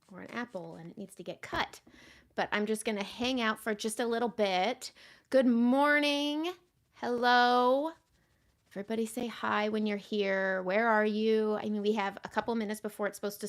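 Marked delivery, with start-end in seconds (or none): 5.3-7.9 drawn-out, higher, louder good morning; bright greeting. Real, not acted. Host greeting viewers joining her live stream, drawn-out and louder. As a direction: sing out a welcome to the viewers.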